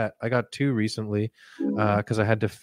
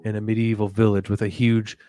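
guided meditation; 2 semitones lower, 4 dB quieter than usual. Acted. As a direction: drift them away gently. Meditation parody.

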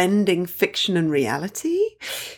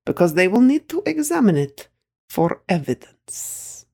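put-on higher, solemn Russian-accented old woman, slowing at the end; granny's Russian accent; 7 semitones higher than usual. Granny warning Masha over the commode, solemn Russian accent. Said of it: "points this tiny little hand at the bowl and says".

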